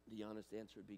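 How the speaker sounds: ordinary talk, nothing marked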